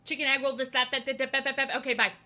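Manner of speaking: rattling through an order, loud and businesslike; takeout order-taker. Retold or imitated. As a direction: imitate a brisk kid taking phone orders. Imitates brisk takeout kid on phone.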